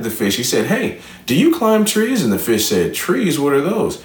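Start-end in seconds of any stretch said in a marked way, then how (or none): 0.7-2.2 lightly voiced friendly panda, high pitch; high-pitched storybook panda invitation; panda character. 2.9-4.0 mildly surprised, higher-pitched questions; a touch of storybook bewilderment; puzzled fish. Acted. Fish puzzled by unknown trees.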